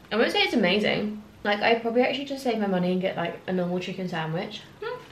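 talking while chewing; just swallowed burger bite; mouth partly full. Real, not acted. Seen 0: Bites burger before; mouth seems still chewing early, then clear speech.

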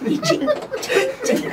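high, laughing ching ching of a spatula scraping; playful scraping sound effect; scraping noise. Retold or imitated. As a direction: mimic the spatula scratching, giggling.

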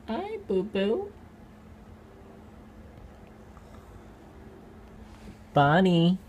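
talking sweetly to the pet in its cage, high and slow; cooing pet talk. Real, not acted. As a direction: coo the pet's name, drawn out and high.

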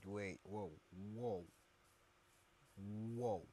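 ordinary delivery

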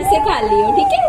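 teasing high coo to a sulking son; playful high sing-song. Pretend. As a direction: pitch it way up like baby talk to a pouting child. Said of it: "laughs, repeats teasingly".

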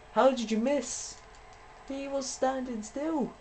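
high, slow, exasperated whine; can't believe what just happened; outraged disbelief. Real, not acted. Opponent misses repeatedly.

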